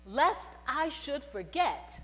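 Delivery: ordinary talk, nothing marked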